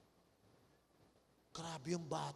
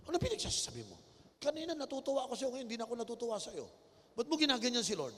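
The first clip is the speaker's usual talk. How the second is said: higher, louder stern rebuke; scolding pastor; 6 semitones higher, 4 dB louder, faster than usual. Acted. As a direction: scold the child sharply.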